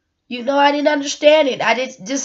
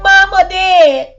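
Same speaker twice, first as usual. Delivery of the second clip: put-on high, loud, slow toddler voice; mock little-girl wail; little child's voice; 8 semitones higher, 8 dB louder, slower than usual. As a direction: imitate a small child crying for mama. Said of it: "my little Mimi would have been like".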